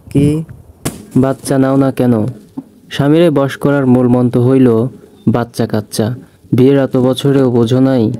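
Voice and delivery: put-on an elderly village woman talking round a mouthful of paan; old neighbour chewing paan. A narrator's reading. An old neighbour advising a young wife, lightly voiced crone chewing paan. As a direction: lecture her like a nosy old aunty, chewing as you go. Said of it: "পান চিবোতে চিবোতে কথাটি বলল প্রতিবেশী একজন বৃদ্ধা".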